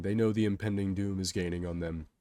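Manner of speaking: ordinary delivery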